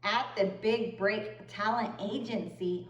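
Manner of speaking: usual speaking voice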